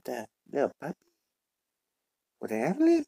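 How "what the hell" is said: startled surprise. Acted. Finds a lost puppy.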